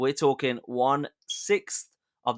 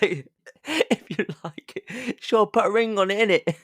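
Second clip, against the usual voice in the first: put-on high British accent through giggles; cracking up while hamming up British slang; mock British impression, struggling not to laugh; 8 semitones higher than usual. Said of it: "with a British accent".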